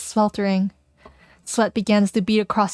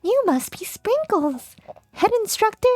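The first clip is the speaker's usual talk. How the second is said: high, slow little dog voice; cute dog voice; 6 semitones higher, slower than usual. Acted. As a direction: play a tiny proud puppy. Voicing Sprinkles the dog professor.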